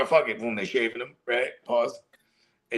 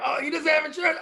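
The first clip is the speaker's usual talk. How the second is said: much higher, shouted shocked line; shocked doctor; 13 semitones higher than usual. Retold or imitated. Friends retelling a movie hospital scene, shocked yelp. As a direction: yelp it in mock alarm. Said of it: "they're like".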